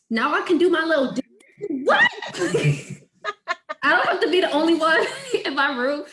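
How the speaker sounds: high, loud and laughing, bursting with joy; excited. Real, not acted. Excited about Afrobeats, laughing loud and high. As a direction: laugh it out with delight.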